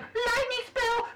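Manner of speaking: high, slow mock monster announcing a spell; playful high creature voice; bird monster voice. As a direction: ham up the enemy casting. Voicing enemy bird.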